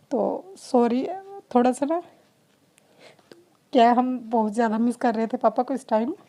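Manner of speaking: real emotion welling up while missing her late father-in-law; getting emotional. Real, not acted. Mother at the temple, missing her late father-in-law, choked up. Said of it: "थोड़ा मैं emotional हो गई हूँ".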